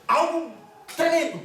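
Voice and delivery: "Algo" and "tremendo" each punched high; greatest possible emphasis. Real, not acted. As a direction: set "Algo" apart, then hit "tremendo" high. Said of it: "intentando aquí poner más énfasis posible".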